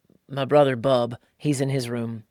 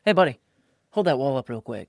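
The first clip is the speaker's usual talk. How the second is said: casual, offhand dad order; nonchalant; 3 dB louder than usual. Retold or imitated. Dad to annoying kid at the table, nonchalant. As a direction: toss it out lightly, no anger. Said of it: "I'm just nonchalant about it".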